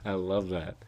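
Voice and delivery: ordinary talk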